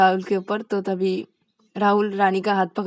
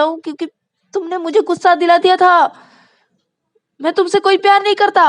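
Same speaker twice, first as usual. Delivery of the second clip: high, loud insisting; pleading protest; 10 semitones higher, 7 dB louder than usual. Acted. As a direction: insist it was said in anger. Pleading, character voice. (ऊँची आवाज़ में सफ़ाई)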